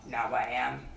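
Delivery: low growly bear, just a hint of growl; lightly voiced growly Pooh, now sure. Pooh answers Christopher Robin in a mild growl. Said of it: "said a growly voice".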